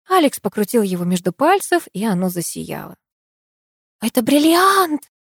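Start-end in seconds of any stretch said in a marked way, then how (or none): 4.0-5.1 high, drawn-out exclamation; exclaiming. A narrator's reading. Diana to the others on seeing the ring, exclaiming. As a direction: cry out, stretching the word. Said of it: "воскликнула Диана".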